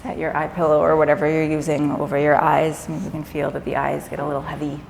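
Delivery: calm yoga nidra guidance with long pauses; guided meditation. Acted. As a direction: ease them gently into rest, leaving space between phrases.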